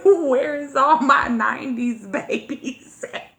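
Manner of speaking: slow, laughing mock shout to the club; club host call-out. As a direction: imitate a host hyping the crowd. Imitates club host calling out.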